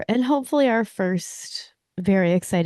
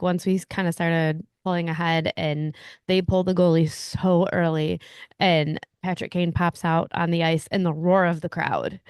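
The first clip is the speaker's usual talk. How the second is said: ordinary talk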